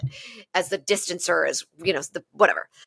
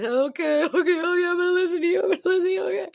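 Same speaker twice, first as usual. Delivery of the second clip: high, crying voice through sobs; tearful; 10 semitones higher, faster than usual. Retold or imitated. Sobbing self answering her inner voice, tearful and high. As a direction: agree through tears, pitched high. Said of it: "I'm listening to his voice".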